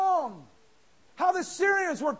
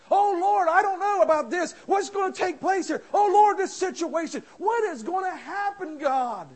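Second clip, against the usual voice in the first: higher, louder fretful pleading; anxious prayer; 4 semitones higher, 5 dB louder than usual. Pretend. Worried believer cries to God, high and fretful. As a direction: fret aloud to God.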